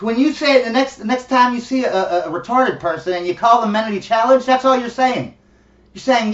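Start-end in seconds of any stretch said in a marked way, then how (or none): none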